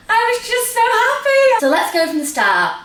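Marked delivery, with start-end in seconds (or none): 0.1-1.6 excited. Real, not acted. Recalling blissful day.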